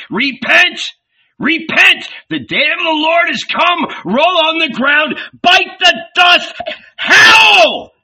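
high, loud, frenzied preaching; prophet shouting. Acted. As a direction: rain fire down on the crowd. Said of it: "The Baptist was shouting".

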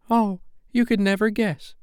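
very high, bell-like fairy voice; high-pitched, excited fairy; excited fairy cry. Acted. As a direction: tease it out in a high little voice. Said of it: "she cried".

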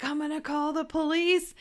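high, loud imitation of a landlady; shouted threat. Acting out the landlady banging on the door, shouted threat.

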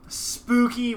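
ordinary delivery

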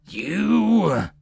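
high, drawn-out accusing cry; outraged. A narrator's reading. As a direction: hurl the word at a rival. Enraged lord confronts the scheming hostess.

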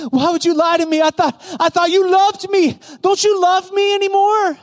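high-pitched wounded protest; upset. Acted. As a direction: plead as a hurt lover, voice climbing. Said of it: "she was upset again".

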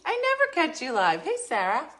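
high, loud excited greeting. Real, not acted. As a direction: greet a friend with glee.

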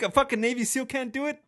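high-pitched mock disbelief; incredulous. Voicing his own disbelief.

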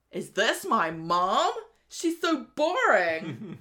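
loud, slow, drawn-out disbelief; mock dismayed child. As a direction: stretch it out like an appalled kid, hammed-up. Acts Miley dismayed at her mother.

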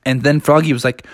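plain reading voice

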